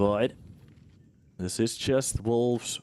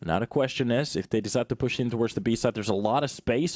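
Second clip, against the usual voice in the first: ordinary talk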